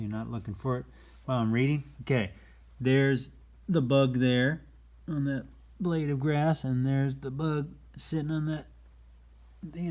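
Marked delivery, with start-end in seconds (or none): 2.8-7.2 excited. Real, not acted. Pointing out hidden bug eagerly.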